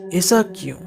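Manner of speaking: usual speaking voice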